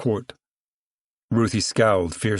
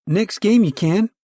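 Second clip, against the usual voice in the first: put-on young girl; 12 semitones higher, 4 dB louder than usual. Pigtailed girl answers Ruthie.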